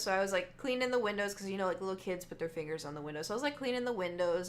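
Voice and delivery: ordinary delivery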